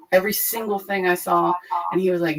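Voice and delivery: normal talking voice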